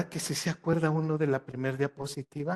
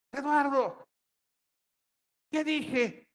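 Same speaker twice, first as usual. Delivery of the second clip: much higher, shrill-voiced mock schoolteacher; high-pitched teacher; 10 semitones higher, much slower than usual. Lecturer mimics a high-voiced teacher scolding a pupil, mock shrill. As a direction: squeak it out like a teacher with a piercing voice. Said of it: "tiene la voz aguda, maestra".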